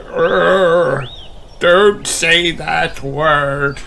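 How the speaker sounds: slow, high groan of an aching belly. A narrator's reading. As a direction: moan it out holding a sore tummy. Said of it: "groans Grandpa Pig".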